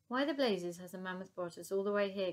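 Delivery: plain reading voice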